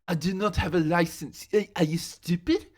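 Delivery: a put-on French accent, scolding a friend; mock French accent. Classmate scolding [name] about cars, in a mock French accent. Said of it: "a French accent ... mimic her yelling at me".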